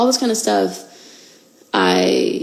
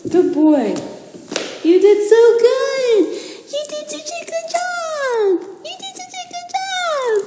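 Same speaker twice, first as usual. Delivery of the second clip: very high, slow, sung-out praise; sing-song praise; slower than usual. Real, not acted. Owner singing praise to her puppy after the stairs, very high. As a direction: croon the praise up high to the dog.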